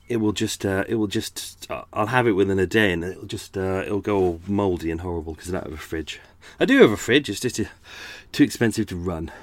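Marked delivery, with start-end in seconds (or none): none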